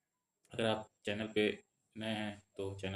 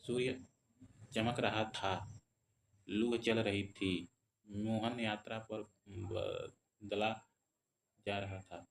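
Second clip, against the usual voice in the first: very slow, drawn-out example reading; semicolon pauses; much slower than usual. Shown as a demonstration. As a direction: stop after "रहा था," and again after "रही थी". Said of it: "अल्प विराम की अपेक्षा थोड़ा देर". (बहुत धीरे, "रहा था," और "रही थी" के बाद ठहरकर)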